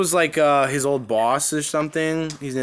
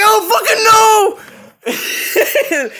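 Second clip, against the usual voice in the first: very high, loud wailing shout; hammed-up teenage outburst; imitated distraught kid; 9 dB louder, much slower than usual. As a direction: wail it back at dad, mock anguish. Acting out the depressed kid.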